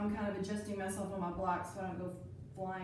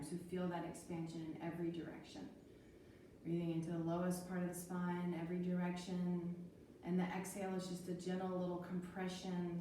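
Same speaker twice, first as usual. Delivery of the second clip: calm guided breathing; 4 semitones lower, 7 dB quieter than usual. Acted. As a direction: guide the breath gently and calmly. Leads calm breathing practice.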